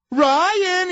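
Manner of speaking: high loud call; mock taunt. [name] calling Ryan across the set, loud and high. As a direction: sing out his name high.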